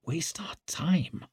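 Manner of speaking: slow, hushed, disbelieving whisper; breath-only, drawn-out question. A narrator's reading. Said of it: "whispered Leo".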